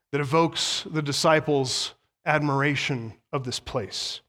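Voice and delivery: normal talking voice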